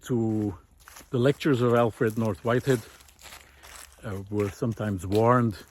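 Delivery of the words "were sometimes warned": normal talking voice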